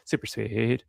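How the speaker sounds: a light Cartman impression, drawn out. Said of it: "that's my best Cartman voice".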